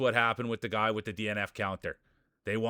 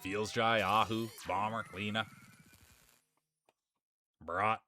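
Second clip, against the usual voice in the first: race announcer. Acted. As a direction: call the racers onto the track like a ringside announcer. Calls the marble race start.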